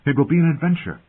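high, lifted, eager delivery; excited promise. Acted. Robb to Bran about riding north, excited. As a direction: build him up with bright anticipation. Brother cheering Bran with plans.

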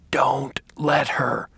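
slow hoarse whisper. Acted. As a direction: rasp it out urgently. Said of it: "he said in a hoarse voice with surprising strength".

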